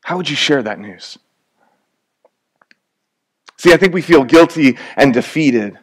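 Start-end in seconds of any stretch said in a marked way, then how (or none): 0.0-1.2 much softer, question dropped low; a hushed rhetorical question to the congregation; softly asked. Shown as a demonstration. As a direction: let it sink in, quietly. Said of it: "You have a cure for a terminal disease".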